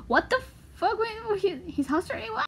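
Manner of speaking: can't believe what you just read; incredulous disbelief. Real, not acted. Shocked by the book's claim.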